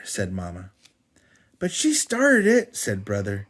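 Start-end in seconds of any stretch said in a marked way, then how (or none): 1.6-2.8 high, loud, slow whine; whiny protest. Acted. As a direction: drag it out defensively. Brother whining protest.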